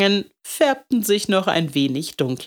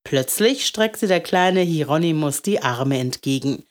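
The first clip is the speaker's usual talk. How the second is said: usual speaking voice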